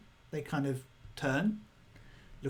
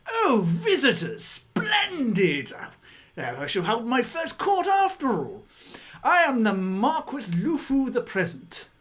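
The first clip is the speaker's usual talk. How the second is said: high, loud, grand noble voice; pompous walrus lord; 9 semitones higher, 7 dB louder than usual. Acted. Walrus greeting visitors, high and loud grandeur. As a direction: announce yourself as a delighted walrus marquis. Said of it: "in the voice that you've heard before".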